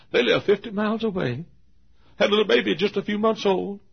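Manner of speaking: high, loud amazement; amazed disbelief. Real, not acted. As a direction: exclaim it as if you can hardly believe it. Amazed at the couple's effort.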